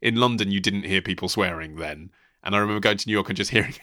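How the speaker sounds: normal talking voice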